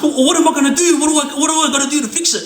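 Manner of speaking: high, fast panicked pleading; mock-scared begging; imitated trembling plea; shaking. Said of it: "he was like, shaking".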